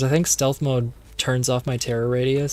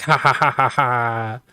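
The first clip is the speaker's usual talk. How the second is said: mock villain laugh; 3 dB louder than usual. Villain Gabriel laughs on the phone.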